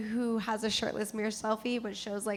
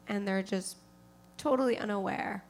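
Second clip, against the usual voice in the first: usual speaking voice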